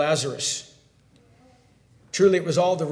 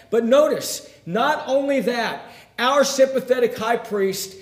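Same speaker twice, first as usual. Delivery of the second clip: raised, emphatic sermon delivery; forceful preaching; 4 semitones higher, 7 dB louder than usual. Acted. Preacher on Jesus holding back his feelings, forceful. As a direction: hit the key words like a preacher.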